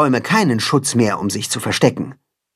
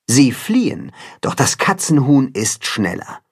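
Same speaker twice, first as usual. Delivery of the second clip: ordinary delivery, nothing marked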